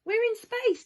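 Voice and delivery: high, loud cry of wonder; excited yell. A narrator's reading. Danny yells to Dinosaur as they float, a high loud excited shout. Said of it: "Danny yelled".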